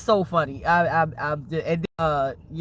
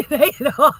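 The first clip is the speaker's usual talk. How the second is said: laughing; 7 semitones higher, 8 dB louder, faster than usual. Real, not acted. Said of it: "laughing repeat".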